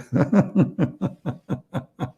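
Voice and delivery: long, high chuckling laugh; a run of amused chuckles tailing off; real laugh. Real, not acted. Laughs at his own story.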